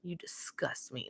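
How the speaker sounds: whispered, venomous; imitated whisper. Vlogger imagines Brittany's secret reply to Danielle, a cold whisper. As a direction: breathe it out with no voice. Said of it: "And Brittany".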